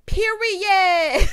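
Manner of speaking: high, loud, drawn-out cheer; gleeful shout. Real, not acted. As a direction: shout it out high, thrilled by the comeback. Gleeful cheer after retold retort.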